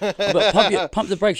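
high, loud, laughing disbelief; re-enacted flabbergasted protest; retold amazed interruption. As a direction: cut in excitedly to halt the caller. Acts out stopping the caller.

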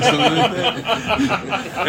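slow, laughing trail-off; laughing as the words drag. Real, not acted. Laughing over own story.

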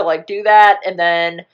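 normal talking voice

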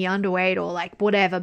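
ordinary talk, nothing marked

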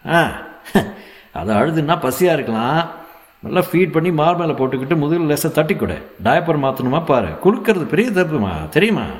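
high, insistent instructions; scolding doctor. A narrator's reading. Doctor teaching mother to soothe the baby, insistent scolding.

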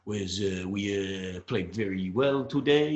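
slow, halting put-on foreign accent; Joey Barton. Co-host imitating an interview abroad, halting foreign accent. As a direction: mimic a footballer faking a foreign accent, haltingly. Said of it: "Joe Barton was funny when he was like".

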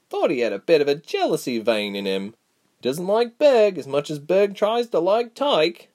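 a sharper voice, irritated but caring; stern Reshma. A narrator's reading. Reshma's dialogue.

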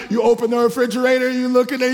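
ordinary talk, nothing marked

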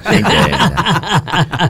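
high, slow, laughing drawl. Real, not acted. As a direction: stretch it out through a laugh. Repeat drawn out with laugh.

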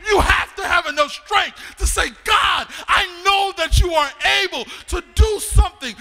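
loud, high, shouted sermon climax; preacher shouting the dare to the congregation; preaching at full force. Acted. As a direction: preach the crowd up to a roar.

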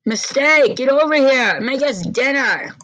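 loud, harsh shout from across the house; an angry father shouting for his daughter to cook; yelling father. Acted. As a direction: bark the order like a cruel father. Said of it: "Endeavor yells".

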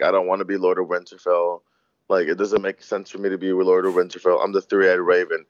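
low, monotone mock quote; Bran. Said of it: "he kept saying this entire time".

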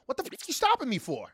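mock outraged driver. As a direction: act out an entitled driver snapping at police.

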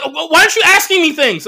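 high, loud whiny mock complaint; mock girlfriend complaint. Imitating girlfriend demanding he ask questions, high and loud. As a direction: whine it like the offended girlfriend.

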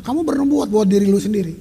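ordinary talk

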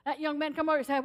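normal talking voice

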